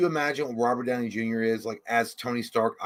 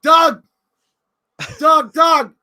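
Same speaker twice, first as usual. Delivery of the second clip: high shouted name call; calling a celebrity's name across the lot; paparazzi yell; 14 semitones higher, 11 dB louder, much slower than usual. Retold or imitated. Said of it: "yelling like a paparazzi".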